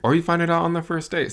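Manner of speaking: high, loud, fast exclaim; high, quick excited outburst. Real, not acted. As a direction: burst out with it. Exclaiming about finding out early.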